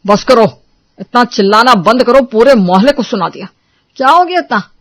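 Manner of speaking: loud, firm call to stop; husband orders the arguing wives to stop; stern shout. Acted. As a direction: shut the quarrel down. Husbands break up the fight.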